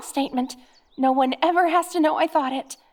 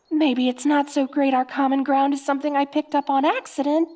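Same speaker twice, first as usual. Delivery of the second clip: ordinary talk, nothing marked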